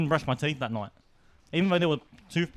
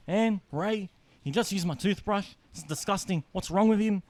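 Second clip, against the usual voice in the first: tattling to mum; 7 semitones higher than usual. Retold or imitated. Snitching to mum.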